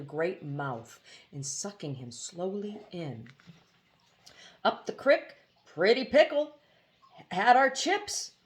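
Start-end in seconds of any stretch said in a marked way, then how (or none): 4.6-8.3 high, glum bird voice, the last line slowed; lightly voiced bird, sighing over bad luck; gloomy hoopoe. A narrator's reading. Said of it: "disconsolately remarked".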